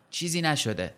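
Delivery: ordinary delivery, nothing marked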